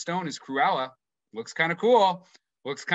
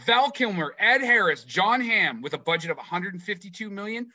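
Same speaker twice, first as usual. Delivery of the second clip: usual speaking voice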